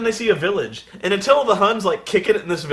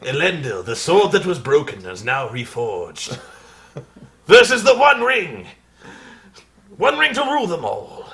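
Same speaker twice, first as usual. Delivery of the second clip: mock epic narration; 3 semitones lower than usual. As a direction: announce it like a fantasy epic. Epic Lord of the Rings parody.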